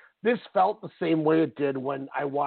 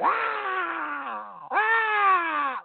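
mock announcer scream; 12 semitones higher than usual. Bleeding announcer screaming at the camera, hammed-up yell. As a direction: ham up a bleeding man's yell. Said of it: "just screaming".

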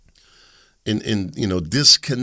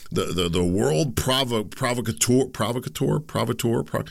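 stammering, repeated stabs at a word; real stumbling false starts; tongue-tied; 3 semitones higher than usual. Real, not acted. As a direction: trip over it, retry, then give up. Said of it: "I can't talk right".

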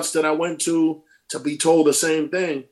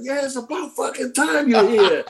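high, laughing mock yell of teammates; retold yelling; 10 semitones higher than usual. Teammates greeting the rookie with a laughing mock yell. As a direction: imitate teammates hollering, half laughing. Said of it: "they all started yelling".

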